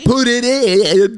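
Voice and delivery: high, very drawn-out put-on voice; Forrest Gump. Man role playing Forrest Gump as lover, exaggerated impression. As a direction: stretch it slowly like the movie simpleton. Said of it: "We're doing a Forrest Gump impression".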